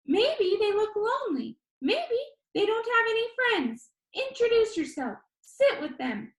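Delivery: high, bright-voiced video host; upbeat YouTuber. A narrator's reading. YouTuber Danny asks the viewer, upbeat and high. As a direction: pitch it up like an eager vlogger.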